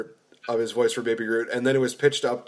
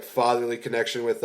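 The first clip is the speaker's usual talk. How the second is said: ordinary delivery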